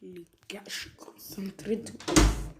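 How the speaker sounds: hushed, voiceless whisper; whispering to viewers while concentrating. Real, not acted. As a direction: whisper as if focusing hard. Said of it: "je vais me concentrer".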